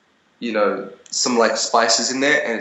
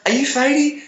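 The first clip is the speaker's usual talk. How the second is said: high, eager fan voice, put-on; imitating a fan. Fan on the street asks if he is Fady, high excited voice. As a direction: squeak it out like a starstruck girl. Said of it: "She's like".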